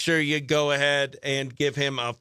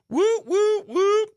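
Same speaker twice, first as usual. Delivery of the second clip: high party whoops; celebratory radio whoop; whooping cheer; 14 semitones higher, 4 dB louder, slower than usual. Real, not acted. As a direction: let out a string of high whoops. Cheering on-air.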